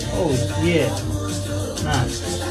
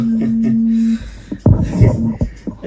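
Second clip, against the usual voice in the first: laughing; 9 semitones higher, 3 dB louder than usual. Real, not acted. Laughs at own invitation to call.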